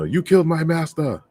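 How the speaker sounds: high mock kung fu avenger, hammed-up; kung fu movie line. Mocking the master teacher talk as a kung fu flick, hammed-up. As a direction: toss it off like a cheesy dubbed martial arts hero. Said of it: "an old Chinese kung fu flick".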